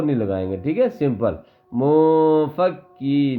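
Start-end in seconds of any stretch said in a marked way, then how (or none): 1.7-3.4 منفکین slow, with the kaf kept light and unforced; light kaf demo. Shown as a demonstration. Teacher shows a child منفکین with a light kaf, slow and simple. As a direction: say منفکین gently, no force on the kaf. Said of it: "کاف کو باریک پڑھیں گے".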